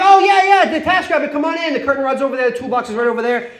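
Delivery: high, eager imitated girlfriend; high chirpy hostess, starting loud; breezy welcome.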